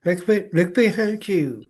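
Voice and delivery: high imitated call to the screen; talking to the TV. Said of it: "talking to the T V".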